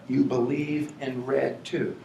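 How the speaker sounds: ordinary talk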